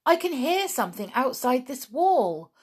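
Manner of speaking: alert, urgent discovery; a boy hearing rescue close; excited. Acted. Julian hears noises, excited.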